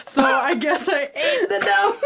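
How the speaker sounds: helpless giggling while owning up; laughing confession. Real, not acted. Laughing over the eaten note.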